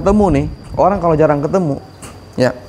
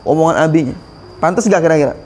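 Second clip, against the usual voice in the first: ordinary talk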